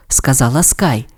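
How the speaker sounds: plain reading voice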